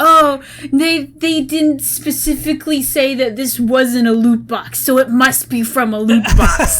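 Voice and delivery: hammed-up skeptic with a loud, slowed delivery; put-on voice of a suspicious fan; BioWare skeptic. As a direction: draw out the mock suspicion, ending close to laughter. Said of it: "I like your BioWare skeptic voice".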